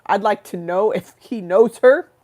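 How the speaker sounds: loud menacing tough voice; a loud menacing figure threatening the podcaster; threatening character. Pretend. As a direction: warn them someone's gonna pay.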